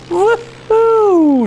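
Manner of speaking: very high, loud gleeful squeal; thrilled whoop. Acted. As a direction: whoop with pure joy. Animals flying home after winning.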